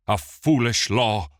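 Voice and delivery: loud, bitter spat words. A narrator's reading. Regent spitting contempt at the law. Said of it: "Mirthandir spat".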